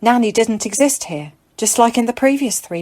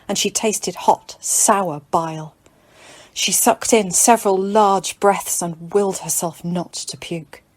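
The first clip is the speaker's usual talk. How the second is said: ordinary delivery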